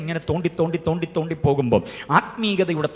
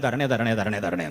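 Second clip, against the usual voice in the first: softer, lower mock mumble of "തരണേ" with falling ends; mock whiny begging; 6 semitones lower, 6 dB quieter than usual. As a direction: drone "തരണേ" low and quiet like a tired old habit. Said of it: "എന്നാ, അല്ലേ?". (താഴ്ന്ന ശബ്ദത്തിൽ "തരണേ" എന്ന പരിഹാസ യാചന)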